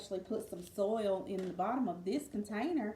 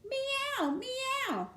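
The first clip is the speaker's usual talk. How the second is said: very high mewing meow; high squeaky cat meow. Acted. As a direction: mew like a little cat. Cat sound in story.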